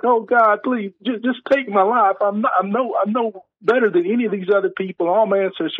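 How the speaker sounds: mock whimpering plea; whining self-pity. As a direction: beg God to take your life, self-pitying. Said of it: "he's just whining and moaning and crying".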